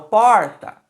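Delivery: "porta" with "por" punched out; stressed first syllable. Shown as a demonstration. Teacher asking which syllable stands out, "por" in "porta" stressed. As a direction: lean hard on the "por" of "porta". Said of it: "qual é a sílaba que se sobressai?".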